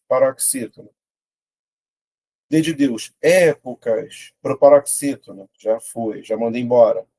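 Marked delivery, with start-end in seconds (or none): 3.2-4.3 "Épocas" slow, É punched and held; stressed first syllable. Shown as a demonstration. Teacher sounding out the word, first syllable stressed. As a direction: stretch the É of "Épocas". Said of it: "Vou separar".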